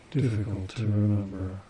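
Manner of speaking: normal talking voice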